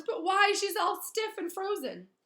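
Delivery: high, loud wailing caretaker; distraught Filch. Acted. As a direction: plead in anguish over the cat. Filch distraught over his cat.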